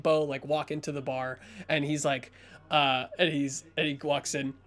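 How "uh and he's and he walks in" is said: normal talking voice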